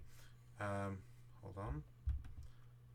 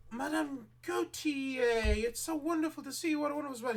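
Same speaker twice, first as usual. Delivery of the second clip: gushing shopkeeper; 4 dB louder than usual. Acted. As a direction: fawn over the arriving lady, much higher. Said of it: "she's like".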